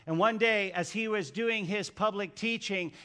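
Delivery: ordinary delivery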